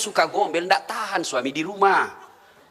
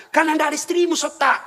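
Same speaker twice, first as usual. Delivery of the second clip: mock scolding; 7 semitones higher than usual. Ustaz mocks student, playful retort.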